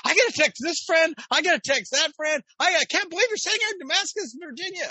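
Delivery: high, loud, fast excited gush; giddy excitement. Retold or imitated. Starstruck fan rushing to text her friends, high fast gush. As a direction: gush it out, bursting to tell everyone.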